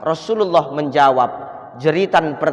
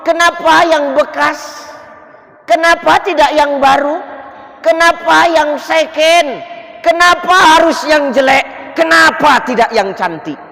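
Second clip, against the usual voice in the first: Sya'ban screaming again as he dies, high and loud; second scream; 10 semitones higher, 4 dB louder than usual. Retold or imitated. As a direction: wail each question. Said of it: "Jeritan kedua dia ngomong".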